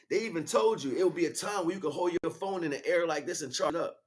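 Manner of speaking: normal talking voice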